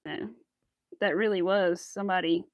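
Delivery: ordinary talk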